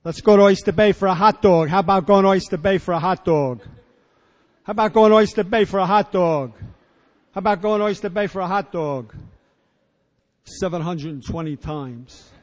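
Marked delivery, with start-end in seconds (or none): 0.0-9.2 loud, high, insistent whining plea; pestering whine, louder each time; mock nagging. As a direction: nag it over and over like a kid. Nagging repeated request, 720 times.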